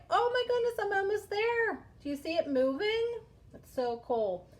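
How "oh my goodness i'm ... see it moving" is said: high, loud delighted exclamation; excited. Real, not acted. Teacher to pupils, caterpillar almost there, excited. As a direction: cheer on the race as it nears the finish. Caterpillar nearly at the finish line.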